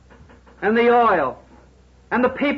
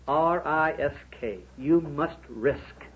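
slow, softer, each letter of "R I S K" spaced apart; spelled out slowly; 5 semitones lower, 5 dB quieter, slower than usual. Shown as a demonstration. Preacher spelling out "risk" for the businessmen, slow and deliberate. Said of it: "spells word for emphasis".

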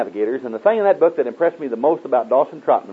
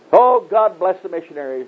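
lightly imitated earnest prayer, pitched up; mock praying; 7 semitones higher, 3 dB louder than usual. Believer praying vaguely to God, mock praying.

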